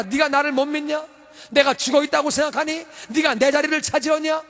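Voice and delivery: high, loud indignant rebuke; angry God. Retold or imitated. God scolding Miriam, high and loud. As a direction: demand it of her, outraged. God's angry speech voiced.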